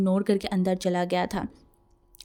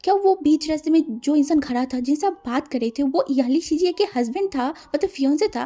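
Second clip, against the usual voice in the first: higher and louder, eager question; high, loud excited asking; eager blurt; 5 semitones higher, 4 dB louder than usual. Acted. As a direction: burst in with curiosity. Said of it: "तभी wing बोल उठा".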